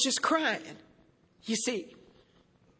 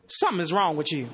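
stern father; 9 semitones lower than usual. Retold or imitated. Father scolding in retold story.

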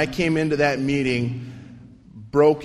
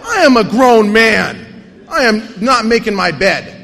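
defiant refusal; 4 semitones higher, 7 dB louder than usual. Retold or imitated. Refusing sponsor.

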